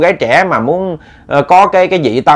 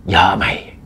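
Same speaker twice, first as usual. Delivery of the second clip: hushed sinister reply; low menacing growl; 6 semitones lower, 6 dB quieter, slower than usual. Acted. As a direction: drop low and soft, menacing.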